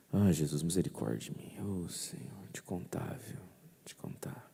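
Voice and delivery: soft, fading plea for mercy trailing to a whisper; remorseful plea. Shown as a demonstration. Priest leading the congregation in a whispered plea. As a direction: beg quietly for mercy. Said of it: "Faz assim comigo".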